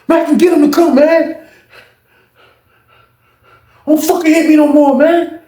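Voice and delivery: high, loud desperate plea; voicing a beaten inmate shouting in desperation; begging shout. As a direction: beg him to stop hitting you.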